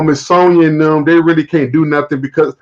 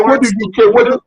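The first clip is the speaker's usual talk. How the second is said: mock nagging; 6 semitones higher than usual. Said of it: "He said".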